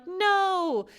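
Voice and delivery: high mock cry of no. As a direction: cry out against it, pitch up. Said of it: "we're like".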